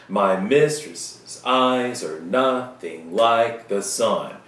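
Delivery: slow, beating every second syllable; My mistress's eyes are nothing like the sun in heavy iambic stress; sing-song iambic reading. Shown as a demonstration. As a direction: read it da-DUM da-DUM like a bored teacher. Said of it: "if you read it like that".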